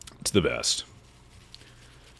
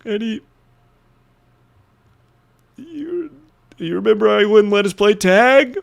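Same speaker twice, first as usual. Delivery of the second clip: much higher falsetto put-on voice, louder at the end; mocking voice; 14 semitones higher, 4 dB louder than usual. Teasing about the step-grandpa who banned tag, high mock voice. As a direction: needle the memory in a silly high voice.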